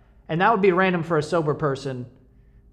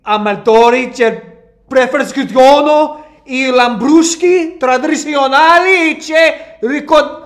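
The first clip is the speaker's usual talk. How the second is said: loud, slow, hammed-up Italian accent; exaggerated Italian accent; 7 semitones higher, 11 dB louder, slower than usual. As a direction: belt out the label like an Italian opera host. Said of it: "this whole bottle is written in Italian".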